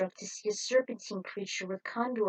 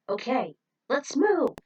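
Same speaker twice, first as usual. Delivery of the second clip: firm rally; 4 semitones higher, 7 dB louder than usual. Acted. Rallying the team.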